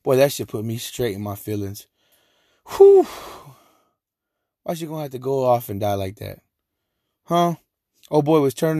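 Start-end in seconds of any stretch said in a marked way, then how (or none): 2.7-3.1 loud, high exhaled whew; emotional whew. Real, not acted. Host recalling a film that hit his feelings, emotional whew. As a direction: let out a big high whew. Moved by a sad film memory.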